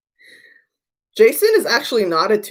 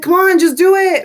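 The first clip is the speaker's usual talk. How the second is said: high, louder mock coaxing; pushy urging; 9 semitones higher, 5 dB louder than usual. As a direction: cheer her on, pushily. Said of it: "And he's like".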